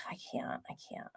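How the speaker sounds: breathy, unvoiced whisper after a sigh; exasperated whisper. Real, not acted. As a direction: say it under your breath, defeated. Overwhelmed sigh, trailing off.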